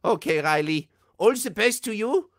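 put-on high, loud German-accented doctor; Medic character voice. Reading a fan's request as the Medic. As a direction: play the excited German Medic. Recorded Medic video message.

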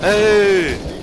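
sudden loud yell; shout. Real, not acted. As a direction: call out sharply. Sudden shout during game.

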